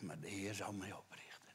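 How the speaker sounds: whispered promise, hushed and intimate; a whispered word of faith; soft whisper. Acted. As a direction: breathe it out tenderly. Brother's words voiced softly.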